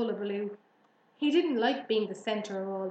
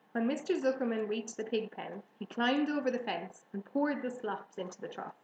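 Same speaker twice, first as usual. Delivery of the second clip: ordinary delivery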